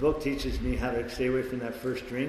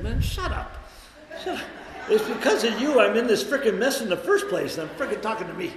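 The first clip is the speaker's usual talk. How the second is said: high, louder, exasperated self-scolding; scolding himself; 9 semitones higher, 4 dB louder than usual. Retold or imitated. Man scolds himself while driving, high exasperated scolding. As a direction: snap at your own inner voice. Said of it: "and I tell you".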